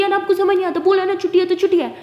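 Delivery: angry shout at the tutor; angry outburst. Acted. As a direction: snap back in a temper. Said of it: "गुस्से से बोल देता है".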